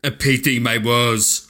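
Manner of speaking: lightly imitated South African villain, drawn out; South African accent. Quoting a doomed man in the movie, mock South African accent. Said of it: "comment on the accent".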